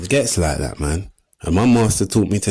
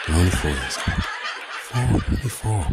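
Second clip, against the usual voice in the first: softer, low, half-laughing reassurance; soothing himself; 5 semitones lower, 6 dB quieter than usual. Acted. Telling himself they're only kids, soft and laughing. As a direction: coax yourself calm. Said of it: "calm down".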